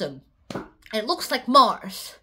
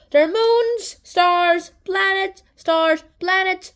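excited listing; 4 semitones higher, 6 dB louder, slower than usual. Real, not acted. Excited listing of space things.

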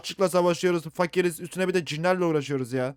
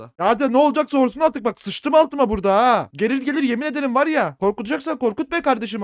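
higher, loud frazzled yelling; nervous shout; 8 semitones higher, 7 dB louder than usual. Real, not acted. Scared gamer daring the ghost to strike, tense shout. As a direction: shout out the built-up tension. Tense waiting in horror game.

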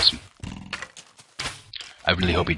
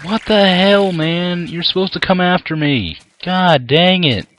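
exasperated outburst; 10 semitones higher, 4 dB louder, slower than usual. Real, not acted. Creeper not chasing him.

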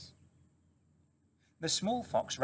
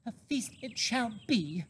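revived mother fox; 11 semitones higher than usual. A narrator's reading.

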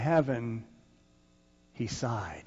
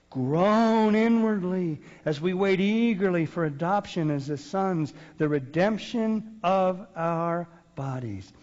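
louder, higher, slow preaching; fervent scripture reading; 6 semitones higher, 6 dB louder, slower than usual. Real, not acted. Preacher reading Romans aloud to the church, fervent and slow. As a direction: proclaim it slowly with rising fervour.

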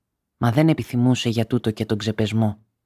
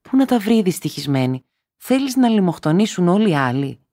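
higher, louder anguished protest; distressed wife; 7 semitones higher, 3 dB louder than usual. A narrator's reading. Wife protesting her family would starve, higher and loud. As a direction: plead against the cruelty.

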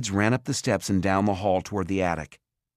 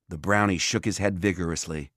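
ordinary delivery, nothing marked

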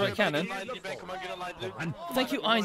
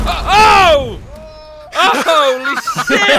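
loud startled yell, pitched up; shocked shout; 8 semitones higher, 13 dB louder, slower than usual. Real, not acted. Direct hit on their cannon.

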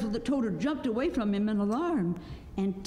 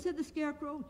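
ordinary delivery

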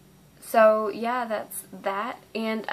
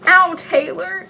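pained yelp; 9 semitones higher, 7 dB louder, slower than usual. Real, not acted. Second paper cut, blaming Taylor.

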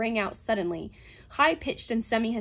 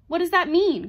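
loud, high challenge; sharp raised-voice question; crying out; 9 semitones higher, 11 dB louder than usual. A narrator's reading. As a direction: cry it out indignantly. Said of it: "cried Calvin".

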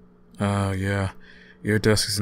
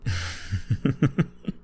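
a light breathy laugh; 7 semitones higher than usual. Acted. Teasing roommate laughs.

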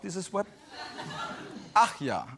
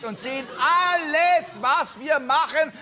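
higher, louder and slow, hammering it home; building rant; 9 semitones higher, 3 dB louder, slower than usual. Acted. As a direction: build to a comic climax. Climactic rant about women watching. (steigernd, lauter und langsam zum Höhepunkt)

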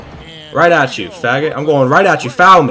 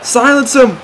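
excited shout; 10 semitones higher than usual. Real, not acted. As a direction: yell it to hush the arena. Shouting at crowd in game.

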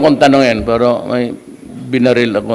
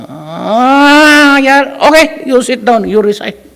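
much higher put-on voice of a fussy law professor; mock professor; 11 semitones higher, 3 dB louder, slower than usual. Professor scolding two students standing in class, high mocking impersonation. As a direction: play the strict professor in a high, slowed mocking voice. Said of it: "Sabi ka agad ng mata na".

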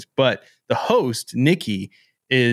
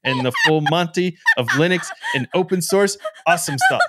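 Jill; 8 semitones higher than usual. Retold or imitated. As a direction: imitate a cohost's signature outro. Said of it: "I wanted to be Jill so bad".

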